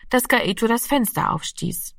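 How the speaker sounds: ordinary delivery, nothing marked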